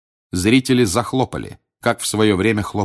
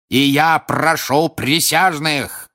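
slow, loud, higher bellow; shouting at full lung; 7 semitones higher, 4 dB louder, slower than usual. A narrator's reading. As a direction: roar it to the jury. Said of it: "крикнул Крауч во всю силу лёгких".

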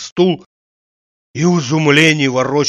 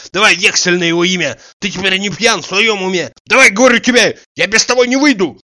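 high, insistent angry shouting; shouted demand; 5 semitones higher, 4 dB louder, faster than usual. A narrator's reading. Tarantiev demanding a promissory note, rising to a shout. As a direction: build up to a loud ultimatum. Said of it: "кричите так?".

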